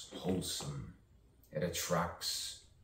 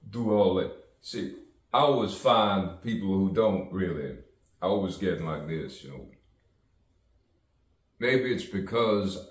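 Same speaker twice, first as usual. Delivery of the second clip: louder, pushed city-street voice; 7 dB louder than usual. Real, not acted. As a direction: project it out like talking over a crowd. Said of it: "I change my voice sometimes".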